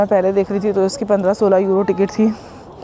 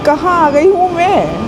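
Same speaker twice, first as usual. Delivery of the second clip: higher, slow, drawn-out "गई" in mock despair; exaggerated disbelief; 8 semitones higher, 5 dB louder than usual. Real, not acted. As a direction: stretch "गई", really lost now. Lost, asks herself twice.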